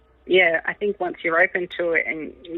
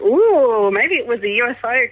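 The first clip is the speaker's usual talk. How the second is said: excited ooh; 5 semitones higher, 5 dB louder than usual. Retold or imitated. Mum gets excited about UFO.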